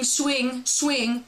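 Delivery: ordinary delivery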